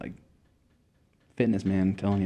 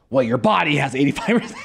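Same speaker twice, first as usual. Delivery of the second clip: abrupt announcement; 7 semitones higher, 5 dB louder than usual. Retold or imitated. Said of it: "And then out of nowhere".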